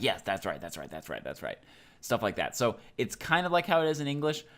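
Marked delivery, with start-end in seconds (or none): 0.0-1.6 fading repeated agreement. Retold or imitated. Teacher translating the casual so so so, fading off. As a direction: let each that's right fade out.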